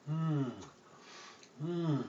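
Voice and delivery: soft content hums; savoring hmm. Acted. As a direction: hum like biting donuts. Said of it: "the noise I made".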